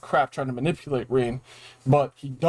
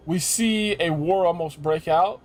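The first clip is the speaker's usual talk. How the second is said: ordinary delivery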